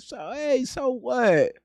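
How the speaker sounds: sarcastic impression of a smug attorney; mocking the attorney. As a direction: parody the lawyer's careless shrug. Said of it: "That's pretty much what he said".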